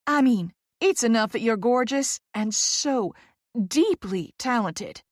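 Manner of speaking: "deeply talented" drawn out slowly; emphasizing each word. A narrator's reading. Poppy flattering the new fellow, "deeply talented" stressed word by word. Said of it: "emphasizing each word".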